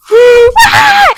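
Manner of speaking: very high, loud laughing yelp; startled yelp. Retold or imitated. Kid bitten back by her mom, high loud laughing yelp. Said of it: "I was like".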